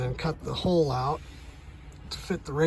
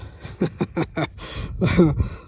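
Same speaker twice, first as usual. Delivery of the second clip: short breathy chuckle at his own joke; amused breathy chuckle; breathy laugh; 2 semitones higher, 4 dB louder than usual. Real, not acted.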